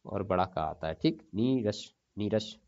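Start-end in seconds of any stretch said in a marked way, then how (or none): 1.3-2.6 नीरस repeated slowly; slow repetition. Shown as a demonstration. Teacher dictating a word to students, slowly. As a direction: dictate the word twice, unhurried. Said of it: "नीरस होता है".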